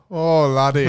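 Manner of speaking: put-on light Scottish accent. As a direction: toss off a bit of Scottish brogue. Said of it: "It's gone Scottish".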